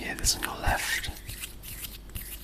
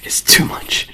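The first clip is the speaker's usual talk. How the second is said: sharp, louder whisper; 9 dB louder than usual. Shown as a demonstration. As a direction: push the whisper out hard. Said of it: "If I blow too hard".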